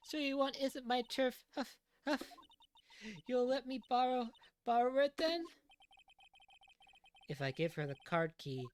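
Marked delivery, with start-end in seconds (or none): 0.1-5.4 put-on high, eager old woman; high old lady voice. Old lady asking to borrow the card key, eager. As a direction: wheedle like a pushy granny.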